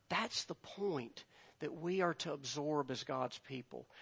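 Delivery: normal talking voice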